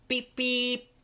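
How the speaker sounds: high sung beep beep like an engine whistle. A narrator's reading. Thomas peeping goodbye as he leaves, high whistle beeps. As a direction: toot it out high and bright.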